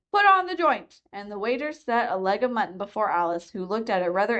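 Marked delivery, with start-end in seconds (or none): 0.1-1.0 high, bossy queen voice; imperious royal voice, pitched up; Red Queen. A narrator's reading. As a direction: lord it over Alice from the head of the table. Red Queen giving orders.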